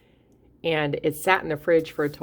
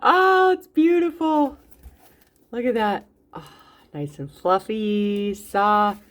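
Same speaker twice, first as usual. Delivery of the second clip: higher, louder and slow, gushing over the loaf; delighted awe; 6 semitones higher, 6 dB louder, slower than usual. Real, not acted. Baker showing viewers her fresh loaf, thrilled and gushing. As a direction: swoon over it like a proud baker.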